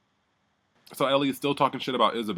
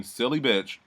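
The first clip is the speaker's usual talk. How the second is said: usual speaking voice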